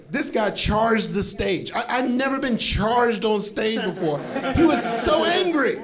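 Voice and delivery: normal talking voice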